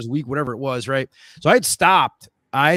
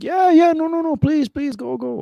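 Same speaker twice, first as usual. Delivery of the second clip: high, insistent mimicked urging; high-pitched, hurried reassurance, hammed up; retold eager hosts; 13 semitones higher, 5 dB louder than usual. Acting out hosts urging him on.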